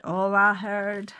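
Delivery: slow mock-American drawl; poor American accent. [name] greets his friend's bride Sally, poor American accent. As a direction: draw it out in a badly faked American accent. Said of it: "my really poor American accent".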